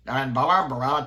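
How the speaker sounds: a hint of Dalek monotone on the blahs; talking like the Dalek. Reviewer lightly mocking the Dalek's dialogue. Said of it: "talking like the Dalek".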